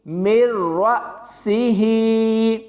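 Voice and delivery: heavy rolled रा cut short, long सी ही; slow tajweed chant, abrupt stop after रा; jerk on hamza. Shown as a demonstration. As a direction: recite मिर रा with a jolt, then stretch ही. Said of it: "झटका देकर पढ़ना है".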